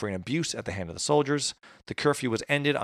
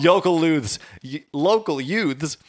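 laughing correction; 6 semitones higher, 5 dB louder, slower than usual. Real, not acted.

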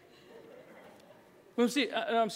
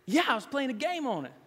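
put-on child's answer; 9 semitones higher, 4 dB louder than usual. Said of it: "he said".

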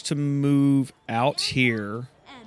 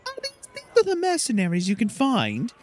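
haughty Silky voice; 10 semitones higher, faster than usual. Acted. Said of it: "So Silky says".

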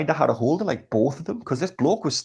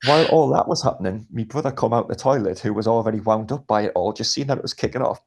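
ordinary talk, nothing marked

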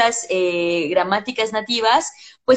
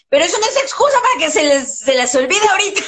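higher and fast, a teasing warning to the class; playful scolding; 6 semitones higher, 3 dB louder, faster than usual. Real, not acted. Teacher teasing her English students, playfully scolding. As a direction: wag a finger at the students with a grin.

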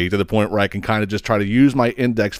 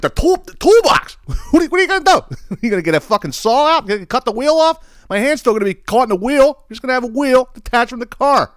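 much higher, fast, disbelieving rant; incredulous outburst; 13 semitones higher than usual. Retold or imitated. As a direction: react like it's the craziest idea ever. Incredulous at toolbox idea.

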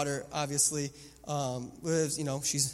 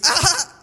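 higher, louder startled yelp; little girl's yelp; 11 semitones higher, 12 dB louder than usual. Retold or imitated. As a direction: yelp as the pillow hits. Daughter jolted by pillow.

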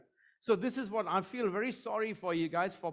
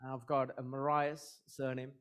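ordinary talk, nothing marked

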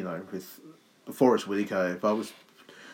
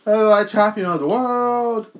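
high, loud mock accent boast; silly Portuguese voice; 9 semitones higher, 5 dB louder, slower than usual. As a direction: boast like a hammed-up wrestler. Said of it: "Just silly".

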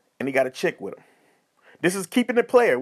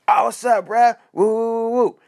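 loud, raised whooping greeting; hollered mock greeting; hyped-up party dude; 5 semitones higher, 5 dB louder than usual. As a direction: imitate an over-hyped friend pulling up. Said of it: "you get the extra turn dude".

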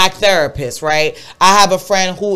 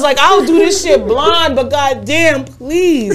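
ordinary talk, nothing marked

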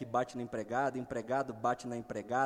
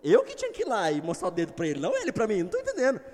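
much higher, incredulous outrage; mock indignant rant; 9 semitones higher, 3 dB louder, faster than usual. Driver ranting at the man who cut him off, much higher and incredulous. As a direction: squeak out the disbelief. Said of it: "Eu falei".

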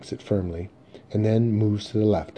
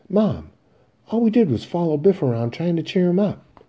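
loud, much higher defensive voice; high-pitched protest; 10 semitones higher, 6 dB louder than usual. Acted. Son defending himself to his mother, high-pitched protest. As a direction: plead your innocence, high and loud.

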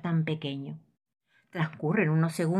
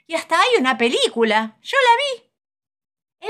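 know-it-all fox; 7 semitones higher, 7 dB louder than usual. Acted. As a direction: show off like a smug fox. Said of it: "le dice el zorro".

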